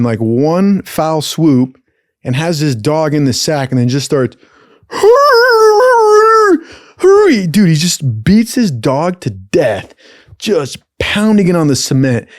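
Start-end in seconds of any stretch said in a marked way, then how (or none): 4.9-7.5 loud, very high drawn-out mock screech; imitated violent swinging. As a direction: screech out the noise of the attack. Acting out the violent sack swinging.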